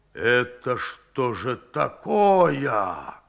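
slow, low, ominous asking; mild menace, drawn out slowly; sinister question. A narrator's reading. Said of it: "зловеще спросил он".

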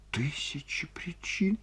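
high, hushed, whispery sob; tearful, half-whispered high voice; sobbing. A narrator's reading. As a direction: whimper it slowly through tears. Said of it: "всхлипнула Ольга Ивановна".

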